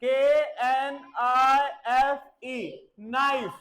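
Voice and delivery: letters spelled slowly, then knife; slow spelling demo. Shown as a demonstration. Teacher showing kids how to spell knife, slowly. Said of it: "देखो जैसे मैं बोल रहा हूं".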